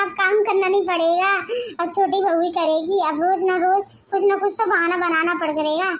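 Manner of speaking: normal talking voice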